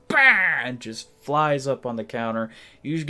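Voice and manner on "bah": sudden shrill-free yelp, high and loud; loud cat screech. Retold or imitated. Cat startling its owner mid-sip, loud high "Bah!". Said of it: "your cat goes".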